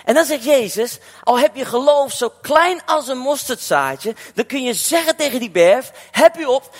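full-force preaching. Acted. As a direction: drive the message home to the congregation.

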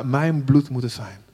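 normal talking voice

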